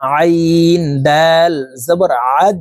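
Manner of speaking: "আইন" and "আদ" slowly, squeezed from mid-throat; throaty ain. Shown as a demonstration. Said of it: "হলকের মধ্যখান থেকে চাপ দিয়ে উচ্চারণ করব". ("আইন", "আদ": হলকের মধ্যখান থেকে চাপ দিয়ে, ধীরে টেনে)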